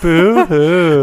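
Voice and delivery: fake crying. Mock sad at losing to Wampler.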